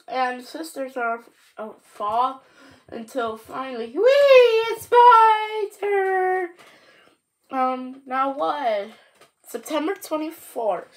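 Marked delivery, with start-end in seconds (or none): 3.9-4.6 high, loud squeal of joy; gleeful whee. Acted. Leaf falling off the tree. 4.7-6.5 high, loud and slow with thrill; excited. Acted. Leaf's turn to fall.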